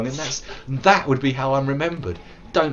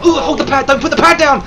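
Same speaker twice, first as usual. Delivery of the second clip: high, loud panicked warning; real in-game outburst; urgent yelling; 13 semitones higher, 12 dB louder, faster than usual. Real, not acted. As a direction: yell it out in alarm. Urgent yell during game.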